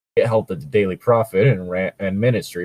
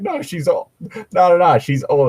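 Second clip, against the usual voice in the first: higher, louder insistent no; imitating the refusal; 9 semitones higher, 4 dB louder than usual. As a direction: brush it off, high and firm. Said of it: "he's like".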